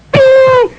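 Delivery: high loud ding; glass hitting sound. Retold or imitated. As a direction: ring it out like a glass strike.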